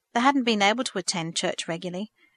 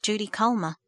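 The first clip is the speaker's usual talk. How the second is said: usual speaking voice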